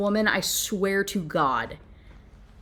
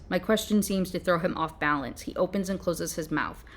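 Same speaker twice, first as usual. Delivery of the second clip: ordinary talk, nothing marked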